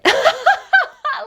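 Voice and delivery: very high, loud laugh that falls away; high burst of laughter. Real, not acted. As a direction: burst out laughing at your own answer. Laughs at own verdict.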